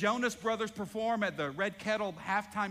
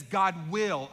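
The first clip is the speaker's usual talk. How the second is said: will pressed hard and louder; stress on will; 6 dB louder than usual. Shown as a demonstration. Pastor hammering one word for the congregation, stress on will. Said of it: "Underline that word will".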